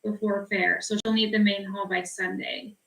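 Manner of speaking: usual speaking voice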